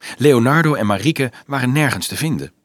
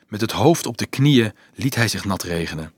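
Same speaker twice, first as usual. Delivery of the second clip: ordinary delivery, nothing marked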